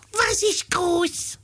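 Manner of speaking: very high, slow squeaky-pitched monkey voice; slow high questioning in monkey falsetto; Äffle cartoon monkey. Äffle quizzing Pferdle in the old cartoon, high monkey voice. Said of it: "das Äffle so".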